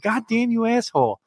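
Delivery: helpless comic cursing after the dog; exasperated retold curse. Owner to dog peeing down hallway, retold exasperation.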